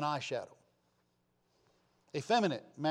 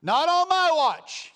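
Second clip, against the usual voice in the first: much higher and louder, slow; defiant shout; 12 dB louder, slower than usual. Acted. Preacher shouting defiance at the congregation.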